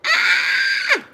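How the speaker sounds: high squeal then a laugh; a real burst of giddy squeals and laughter; excited squeal. Real, not acted.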